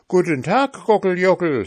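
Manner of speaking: high, boastful pancake voice; cheeky pancake. A narrator's reading. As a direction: taunt them from high up. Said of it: "sagte der Pfannkuchen".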